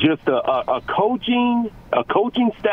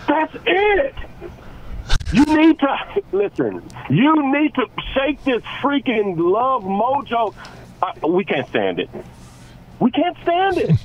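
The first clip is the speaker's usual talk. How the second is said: fired-up rant; 10 semitones higher than usual. Real, not acted. Ranting at the coach.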